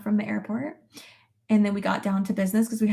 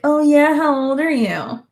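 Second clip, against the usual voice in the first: higher, louder mock teen come-on; forward teenagers; 7 semitones higher, 6 dB louder than usual. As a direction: imitate teens chatting someone up. Imitates teenagers approaching her in pool.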